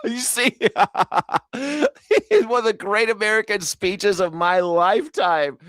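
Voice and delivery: talking through laughter; laughing. Real, not acted. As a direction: laugh while repeating the quote. Laughing at the guest's praise.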